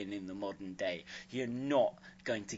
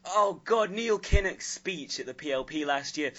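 ordinary delivery

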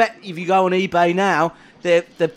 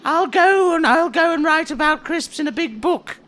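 scribe voice; 7 semitones higher than usual. Acted. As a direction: play the fussy self-important scribe. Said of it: "this character".

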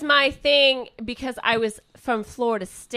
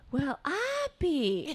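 slow, higher-pitched imitated Southern drawl; mom's Southern-sounding accent; 5 semitones higher, 5 dB quieter, much slower than usual. Recalling mom's voice on old videos, Southern-sounding accent. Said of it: "she has an accent".

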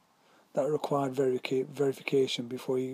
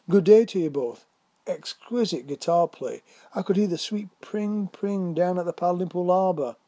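loud, higher, hearty acted hail; called-out greeting; 4 semitones higher, 6 dB louder than usual. A narrator's reading. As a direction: call it across to the picnickers. Warden calls a greeting to picnickers.

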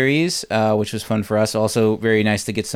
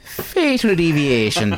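put-on slow, overdone Irish accent; mock Irish accent; 3 semitones higher, slower than usual. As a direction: ham up a stage Irish brogue. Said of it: "can you just do the whole podcast in that accent".

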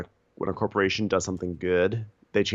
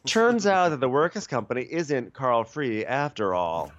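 mock exposé narration; 4 semitones higher, slower than usual. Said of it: "an expose".

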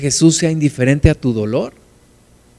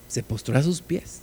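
very soft, reverent repeat; hushed; 3 semitones lower, 14 dB quieter than usual. Real, not acted. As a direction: drop to a hushed, much softer voice. Repeats the verse in hushed awe.

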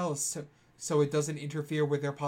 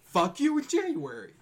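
high shouted catchphrase; 11 semitones higher, 4 dB louder than usual. Acted. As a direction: yell the slogan high. Catchphrase again.

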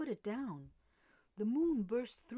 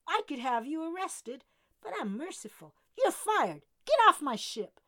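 loud, higher angry tirade, building to a yell; furious shouting; 6 semitones higher, 10 dB louder than usual. A narrator's reading. Captain Jordan firing the mutinous deckhand, rising furious shout. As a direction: fire him with rising rage. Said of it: "he barked".

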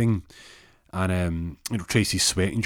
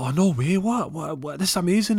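high, excited disbelief; thrilled, tumbling high-pitched reaction; acted amazement; 10 semitones higher, 5 dB louder than usual. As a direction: gush in astonishment, voice jumping up. Husband's amazed reaction to pregnancy.

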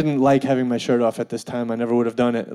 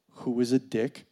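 ordinary talk, nothing marked

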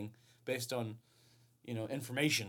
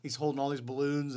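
ordinary talk

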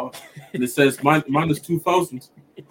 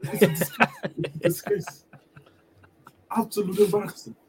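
mock angry Arteta; 5 semitones higher than usual. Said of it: "when Arteta gets angry".

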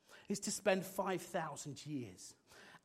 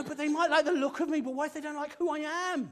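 higher, fast anxious babble; rushed worry; 7 semitones higher, 5 dB louder, faster than usual. Pretend. Single friend fretting about their match, higher and fast. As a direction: tumble out your fears quickly.

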